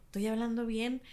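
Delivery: mock timid murmur; quiet mumble. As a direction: mimic a soft-spoken Mexico City friend. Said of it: "hablan bien bajito".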